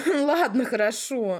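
laughing. Real, not acted. Giving in while laughing.

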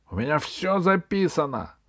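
high-pitched, heated blurt; hot-headed outburst. A narrator's reading. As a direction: let it burst out in a temper. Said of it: "вырвавшееся из запальчивости слово". (запальчивый высокий выкрик)

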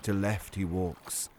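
slow, hushed poetic reading; poem recitation. Acted. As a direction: recite it softly like a fairy tale. Reads Pushkin poem.

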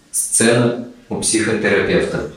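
ordinary delivery

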